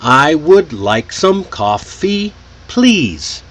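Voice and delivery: "I would like some coffee, please" slow and beat by beat; clapped-out beat. Shown as a demonstration. Teacher drills the rhythm, slow beat-by-beat stress on "I would like some coffee, please". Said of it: "clapping or tapping your hand".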